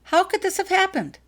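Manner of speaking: high, loud sputter of disbelief. Acted. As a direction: spit the question out in shock. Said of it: "sputtered Dudley".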